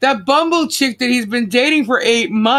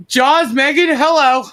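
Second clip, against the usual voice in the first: usual speaking voice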